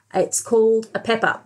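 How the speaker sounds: ordinary talk, nothing marked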